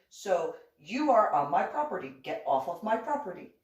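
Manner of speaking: reenacting the fight, loud and high-pitched; angry retold rant. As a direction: order him off your land like you did then. Arguing neighbor off her property.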